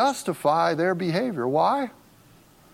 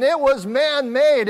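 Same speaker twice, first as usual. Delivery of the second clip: "It was man-made" louder and higher, each word hit slowly; emphatic; 7 semitones higher, 4 dB louder, slower than usual. Real, not acted. Pastor hammering home that the tradition was man-made. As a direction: land "It was man-made" hard and slow. Preacher driving home his point.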